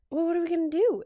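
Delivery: high, loud mock-anxious question; retold worry. Patient asks dental tech about lost filling, retold worry. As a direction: act out a patient at a loss.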